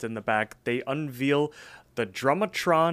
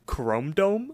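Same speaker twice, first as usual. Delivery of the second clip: higher-pitched questioning echo, rising sharply at the end; imitated indignant echo; 5 semitones higher than usual. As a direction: throw the nickname back as an offended question. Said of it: "And Benson says".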